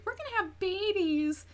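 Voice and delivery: high, mock-naive voice; mocking couple. Vlogger mocking the couple's baby plan, high and silly. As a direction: mimic the couple as clueless and giddy. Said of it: "that couple who was like".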